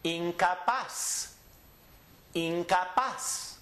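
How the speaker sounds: slow Incapaz, in-ka-PAS; last-syllable stress. Shown as a demonstration. Teacher demonstrates words ending in Z, Incapaz slowly stressed on PAZ. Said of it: "we go up on the N".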